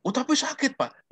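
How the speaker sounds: high, plaintive student voice; hurt student. Retold or imitated. Lecturer voicing his student insisting it hurts, high and plaintive. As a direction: plead like a wounded student.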